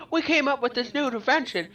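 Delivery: hammed-up eager inventor's announcement; mock excited announcement. Humanity unveiling an AI satellite, mock excited announcement.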